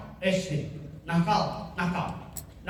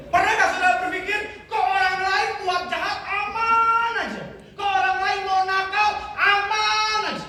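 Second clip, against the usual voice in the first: fervent preaching build; full-force preaching; 8 semitones higher, 4 dB louder than usual. Acted. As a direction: hammer home the unfairness to the crowd. Preaching rant on unfairness.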